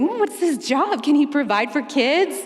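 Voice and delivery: pry like a mother already planning a wedding; nosy mom. Retold or imitated. Mom grilling her daughter about a new guy, high and nosy. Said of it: "her mom is already on Facebook like".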